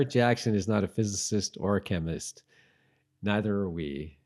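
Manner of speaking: usual speaking voice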